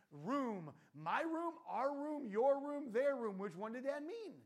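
hammed-up nitpicking of every word; mock over-analysis. Daughter mocked for parsing, rising frantic.

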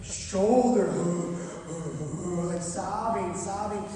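loud, heaving imitation of a sob; mock sobbing. Professor recounts breaking down on the ladder, mock sobbing. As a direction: act out shoulder-shaking sobs, half telling it. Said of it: "sobbing like a child".

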